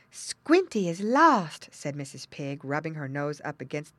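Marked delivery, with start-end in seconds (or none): 0.1-1.7 slow, raised, louder voice; mother pig announcing loss. A narrator's reading. Mother pig tells piglets of loss, drawn-out dismay. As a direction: draw out the bad news. Mother breaks bad news to young.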